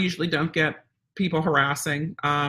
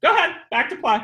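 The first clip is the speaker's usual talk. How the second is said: higher, lighter okay-to-play voice; cheerful release; 9 semitones higher, 3 dB louder, faster than usual. Shown as a demonstration. Trainer letting dogs play again, high cheerful release. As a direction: release the dog brightly, pitched up. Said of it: "let them know it's okay again".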